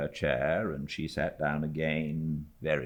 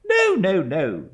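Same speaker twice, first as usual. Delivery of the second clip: higher, louder, brisk dismissal; brusque old aunt; 14 semitones higher, 8 dB louder, slower than usual. A narrator's reading. Stern aunt hushing frightened niece, brusque. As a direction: wave the fuss away. Miss Betsy soothes brusquely.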